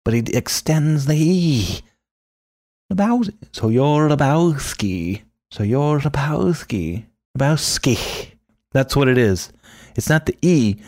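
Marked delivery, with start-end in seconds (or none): 2.9-8.3 higher voice, lips rounded on the "ow" of "Lebowski"; Knox Harrington's Lebowski. Shown as a demonstration. Knox Harrington drawing out the dude's name with a hissed end. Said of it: "wraps his lips around the ow".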